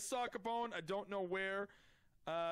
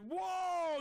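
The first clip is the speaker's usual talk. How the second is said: high loud whoa; startled shout; 11 semitones higher, 4 dB louder than usual. Real, not acted. Streamer reacting in a meeting, high loud shout. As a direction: shout it out in alarm. Shout heard mid argument.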